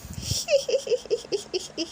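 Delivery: put-on high little-boy giggle; squealing baby laugh. A narrator's reading. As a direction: giggle like a delighted toddler. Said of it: "Laughs George".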